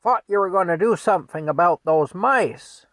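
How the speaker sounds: loud, high-pitched scolding; nagging holler; yelling wife. Retold or imitated. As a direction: yell at your husband to get on with it. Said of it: "she yells at Frank".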